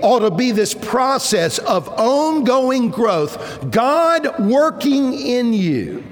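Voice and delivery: a preacher swelling toward God working in you; preaching at full force. Acted. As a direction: drive each phrase home from the pulpit. Preaching climax.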